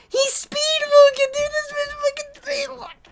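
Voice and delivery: high, amazed squeal; incredulous excitement. Real, not acted. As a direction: crack up at the panel in disbelief. Laughing incredulity at panel.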